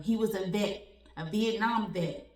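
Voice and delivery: ordinary talk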